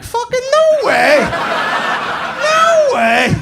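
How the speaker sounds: very high, loud, drawn-out shriek; hammed-up falsetto amazement; feigned astonishment. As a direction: overdo the disbelief in a squealing shout. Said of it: "I had to be all like".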